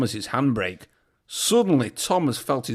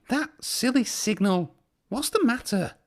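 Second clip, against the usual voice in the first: higher annoyed engine voice; 9 semitones higher than usual. A narrator's reading. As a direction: grumble at the signal. Thomas annoyed at signal.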